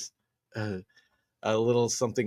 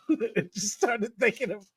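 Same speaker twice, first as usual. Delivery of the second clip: normal talking voice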